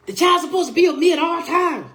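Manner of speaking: high, loud mock grandmother protest; grandmother insisting. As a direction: shout it high like the stubborn grandmother. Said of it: "And she say".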